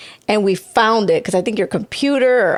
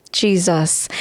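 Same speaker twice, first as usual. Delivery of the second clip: ordinary delivery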